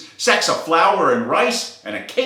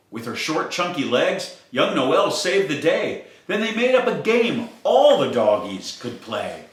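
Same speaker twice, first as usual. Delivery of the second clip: plain reading voice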